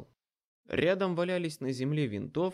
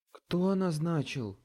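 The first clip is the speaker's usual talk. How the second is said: slow, pressing repeat; slower, firmer repeated demand; repeating menacingly; 5 semitones higher, 3 dB louder, slower than usual. A narrator's reading. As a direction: lean on each word, slowly. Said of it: "повторила она".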